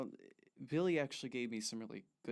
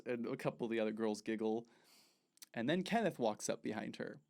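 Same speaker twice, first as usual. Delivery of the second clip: ordinary talk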